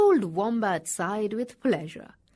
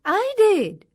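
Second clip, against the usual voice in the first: higher, louder kangaroo reply; mother kangaroo; 9 semitones higher, 8 dB louder than usual. A narrator's reading. Kangaroo answers the startled wombat, higher and louder. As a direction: answer brightly up high.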